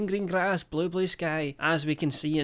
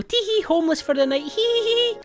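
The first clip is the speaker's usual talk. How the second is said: high giggly mock influencer voice; tittering like a ditzy influencer; 4 dB louder than usual. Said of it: "where they go".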